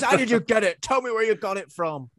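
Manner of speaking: loud, high demanding shout; livid demon. Retold or imitated. Furious demon demands where she got the spear. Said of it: "Oh, he's livid".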